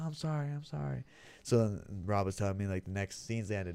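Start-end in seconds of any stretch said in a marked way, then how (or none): 0.0-1.0 high, soft imitated pleading; mock meek apology. Actor apologising to angry co-star, mock meek. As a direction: imitate a sheepish apologiser, pitched up.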